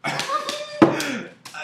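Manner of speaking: squealing laugh; high laugh. Real, not acted. Laughing at own mistake.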